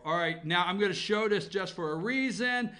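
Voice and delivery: ordinary delivery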